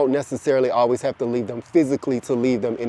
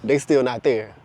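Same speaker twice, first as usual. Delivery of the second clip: usual speaking voice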